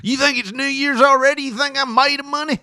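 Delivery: high-pitched ranting dad impression; angry father. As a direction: play an indignant dad scolding his kid. Acts out angry father after Christmas.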